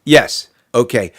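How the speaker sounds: ordinary talk, nothing marked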